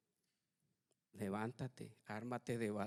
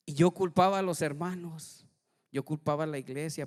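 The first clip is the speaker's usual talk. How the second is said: raised, louder voice on blaming; loud declaration; 4 semitones higher, 9 dB louder than usual. Real, not acted. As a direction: push the blame out loud. Preacher stresses blame.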